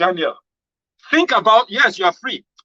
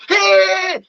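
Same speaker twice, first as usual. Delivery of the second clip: shocked cry; 6 dB louder than usual. Retold or imitated. Retold man shocked by bad news.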